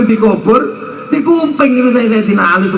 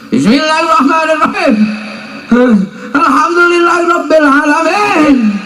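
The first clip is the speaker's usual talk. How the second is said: Umar reciting; 8 semitones higher than usual. Retold or imitated. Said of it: "Sayyidina Umar kalau ngaji begitu".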